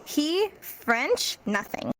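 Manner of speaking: "He French nothing" said slowly, stress on "He"; teacher demoing for the class, "He French nothing" said slowly. Shown as a demonstration. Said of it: "You could say".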